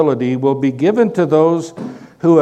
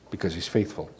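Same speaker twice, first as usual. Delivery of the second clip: much softer echo, almost breathed; hushed repeat; 8 semitones lower, 12 dB quieter, faster than usual. Shown as a demonstration. Preacher repeats the point to his congregation, hushed. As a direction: let the repeat sink in, hushed. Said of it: "the emphasis is on".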